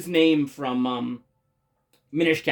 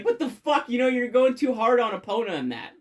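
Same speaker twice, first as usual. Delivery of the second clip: much higher, indignant mock scolding; pissy scold; 6 semitones higher than usual. Midna telling off the player for riding Epona too hard, mock indignant. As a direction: snap like an annoyed friend.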